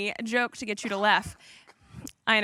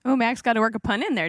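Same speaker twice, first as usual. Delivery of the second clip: ordinary delivery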